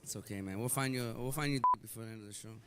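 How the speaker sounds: low, soft reassurance; mock consoling. Comic promising a single guest a match, soft reassurance.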